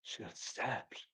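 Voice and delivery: breathy whisper, hushed drama; whispered aside. Pretend. Co-host whispering a spooky aside. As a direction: hush it like a ghost story.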